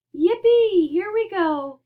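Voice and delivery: high, loud excited cheer; gleeful whoop. Acted. Grandpa Joe cheering as the lift speeds up, high loud whoop. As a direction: cheer the ride on with delight.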